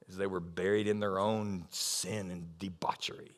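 whispered under the breath; a hushed, pointed aside; soft whispered jab. Real, not acted. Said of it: "unvoiced aside".

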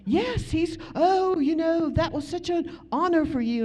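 high, lively British pastor on the phone. Retold or imitated. English pastor phoning the preacher, high and excited. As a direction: play the excited English pastor calling her. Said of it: "He goes".

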